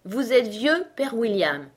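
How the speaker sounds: poem title given as an order; name the piece firmly, falling at the end; commanding. A narrator's reading. Caterpillar telling Alice which poem to recite, commandingly. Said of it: "ordonna la chenille".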